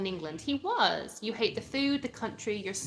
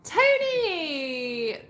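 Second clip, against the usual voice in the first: high, loud, long-held name; calling out; 8 semitones higher, 7 dB louder than usual. Real, not acted. Streamer hailing a viewer on the live, drawn-out high call. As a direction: call the name out, stretched and sliding down.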